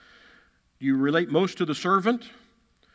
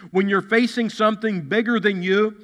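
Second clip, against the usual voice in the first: ordinary talk, nothing marked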